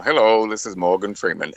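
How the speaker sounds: lightly voiced Morgan Freeman intro; a hint of Morgan Freeman; Morgan Freeman impression. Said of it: "I'll be like".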